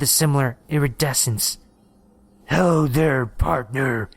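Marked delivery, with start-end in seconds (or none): 2.5-4.1 put-on Texas accent, drawn out and friendly-menacing; slow Texas drawl. A narrator's reading. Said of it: "in a slow Texas drawl".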